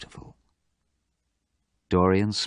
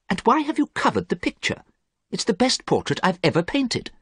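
very high, puzzled protest; acted bewilderment, pitched high; surprised. A narrator's reading. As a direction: ask in astonishment. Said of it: "Basil was very surprised".

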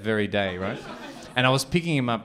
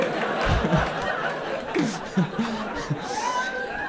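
a real high breathy laugh; laughing at the punchline; 11 semitones higher, 4 dB quieter than usual. Real, not acted. Comic man laughing at his own punchline, real laugh.

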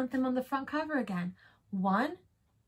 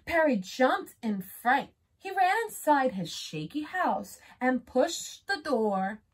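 plain reading voice

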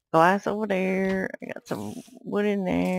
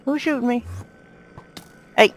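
ordinary talk, nothing marked

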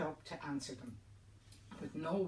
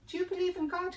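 far higher and louder, urgent; desperate eager question; 8 dB louder, faster than usual. Acted. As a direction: leap at the child with the question. Priest desperately questions child.

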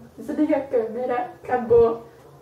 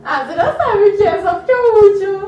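whiny protest; 9 semitones higher, 8 dB louder than usual. Real, not acted. Protesting the last easy guess.

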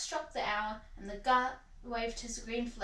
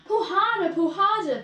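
loud, higher urging; puffing little engine; 6 semitones higher, 8 dB louder than usual. A narrator's reading. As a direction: urge on the engine in front. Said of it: "puffed Thomas to Gordon".

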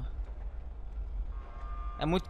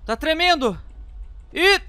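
alarmed; 6 semitones higher than usual. Real, not acted. Gamer reacting to an earthquake in the game, alarmed cry. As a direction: sound scared as the ground starts shaking.

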